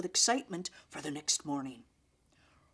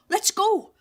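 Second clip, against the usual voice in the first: high, loud burst of excitement; excited; 13 semitones higher, 9 dB louder than usual. A narrator's reading. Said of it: "Stephanie said excitedly".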